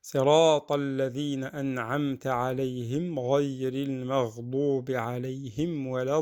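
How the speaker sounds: sustained, even tajwid chanting; Quran recitation. Shown as a demonstration. Teacher reciting the verse for learners, sustained recitation. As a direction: recite with very long held vowels.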